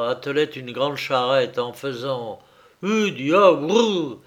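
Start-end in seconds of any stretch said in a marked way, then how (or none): 2.8-4.2 slow, raised carter's call; calling to horses. Acted. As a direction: urge the horse on, drawn out and higher. Driving horses while harnessing. (cri de charretier, traînant)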